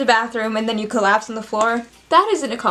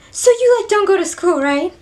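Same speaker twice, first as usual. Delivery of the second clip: other person; 6 semitones higher, 3 dB louder than usual. Acted. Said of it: "I'm the other person".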